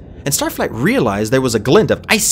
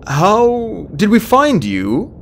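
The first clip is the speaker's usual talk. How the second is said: slow, high, hesitant question; wary, frightened, drawn-out reply; nervous; 10 semitones higher, much slower than usual. A narrator's reading. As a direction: feel your way carefully, pitched up with nerves. Starflight nervously answers frightening dragon.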